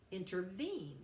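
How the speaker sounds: "intervene" with the weight on VENE; stress on third syllable. Shown as a demonstration. Teacher showing an anapestic foot, "intervene" stressed on VENE. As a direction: lean on the last syllable of "intervene". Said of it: "with the stress on the third".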